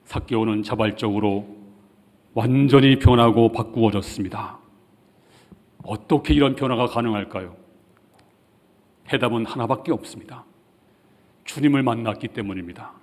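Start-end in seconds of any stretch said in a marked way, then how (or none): none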